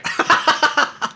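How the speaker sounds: high airy laugh; breathy laugh. Real, not acted. Laughing at the punchline.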